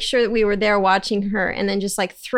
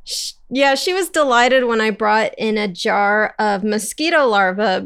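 ordinary delivery

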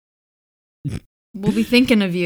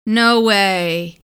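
mock amazement, pitched way up; sarcastic; 8 semitones higher than usual. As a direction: fake wonder, dripping with irony. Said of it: "This is sarcasm".

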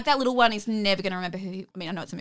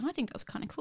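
quiet; 5 semitones higher, 11 dB quieter, faster than usual. Retold or imitated. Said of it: "Katsumoto was like".

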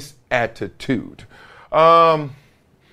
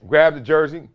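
ordinary talk, nothing marked

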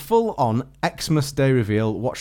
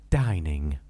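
low drawn heading; 8 semitones lower, 4 dB quieter than usual. Shown as a demonstration. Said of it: "Bit more dynamic".

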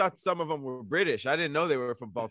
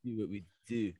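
imitated Baltimore accent; 7 semitones lower, 8 dB quieter than usual. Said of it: "I thought he was a British dude".

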